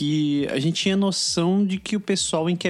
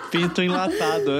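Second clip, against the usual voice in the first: stifled giggles; 7 semitones higher than usual. Real, not acted. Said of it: "Eu não consigo parar de rir".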